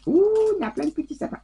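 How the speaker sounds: fast, high-pitched happy surprise; delighted surprise. Real, not acted. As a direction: caught off guard by a lovely find. Discovers little fir trees.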